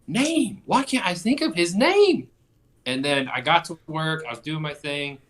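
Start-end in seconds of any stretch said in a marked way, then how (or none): 0.1-2.3 frustrated self. Retold or imitated. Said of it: "And I'm like".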